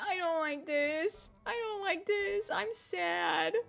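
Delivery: high, slow whimper; mock drugged whine. Drugged teen whining in the car to mom, mock-tearful. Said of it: "Just said".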